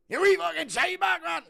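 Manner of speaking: high, screamed Scottish abuse; Scottish accent. Shown as a demonstration. Demonstrating for a viewer, screamed Scottish accent. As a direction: scream the insult in shrieking Scottish. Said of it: "a Scottish accent's a bit more like that".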